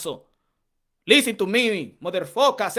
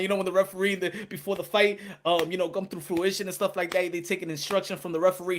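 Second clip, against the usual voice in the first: ordinary talk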